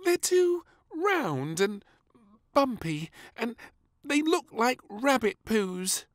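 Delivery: put-on high, slow child pout; sulky little princess. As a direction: refuse the food with a pout. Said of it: "with a frown".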